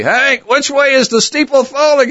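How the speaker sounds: high, loud mock-urgent question. Teasing Hank whenever they meet, mock urgent. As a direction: tease him with the old question.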